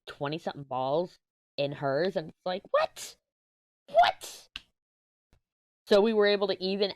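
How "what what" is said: high-pitched squeaked "what?"; cartoonishly high, baffled yelp; incredulous what. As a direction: squeak it in mock disbelief. Mimics stunned reaction to skee balls.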